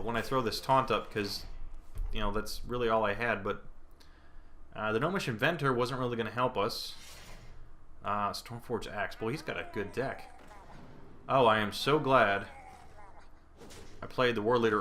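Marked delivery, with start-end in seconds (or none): none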